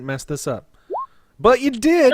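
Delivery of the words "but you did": loud, delighted high shout; excited shout. Real, not acted. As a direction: crow it out gleefully. Failed roll after jinx.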